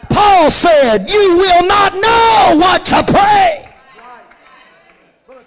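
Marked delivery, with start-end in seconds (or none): 0.1-3.7 full-force preaching. Real, not acted. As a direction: drive it up to a shouted climax. Preaches to a climax.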